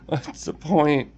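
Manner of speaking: quiet, slow, laughing it off; rueful laugh. Real, not acted. Asking herself what the point is, a quiet defeated laugh. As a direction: let a sad laugh break through the words. Bitter laugh at own despair.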